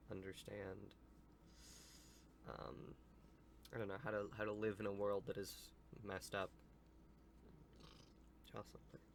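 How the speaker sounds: usual speaking voice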